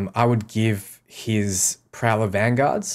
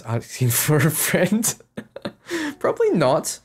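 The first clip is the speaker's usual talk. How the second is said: laughing; 8 semitones higher than usual. Real, not acted. Reads a joke question from chat.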